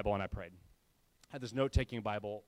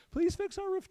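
pleading, pitched way up high; mock pleading; 4 dB louder than usual. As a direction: beg like a sad little kid, hammed-up. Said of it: "sending them sad pictures of my children saying".

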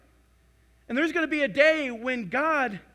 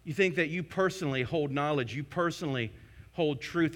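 usual speaking voice

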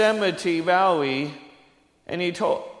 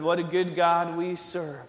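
normal talking voice

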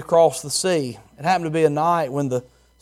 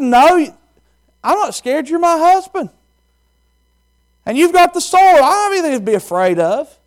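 high, loud, certain wife's reply; trusting wife; 12 semitones higher, 7 dB louder than usual. Acted. Wife answering her husband, high, loud and certain. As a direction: answer him high and sure. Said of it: "She said".

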